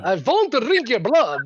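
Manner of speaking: hammy Transylvanian vampire, pitched high; Transylvanian accent. Pretend. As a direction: ham up a Transylvanian Count. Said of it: "terrible Transylvanian accent".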